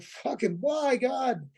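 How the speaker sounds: high, pleading to the sky; mock despair, pitch jumps up; exasperated plea. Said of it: "I'm like".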